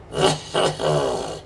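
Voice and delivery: low Yeti growl; Yeti call. Shown as a demonstration. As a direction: grunt out a low monster call in bursts. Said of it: "the Yeti call".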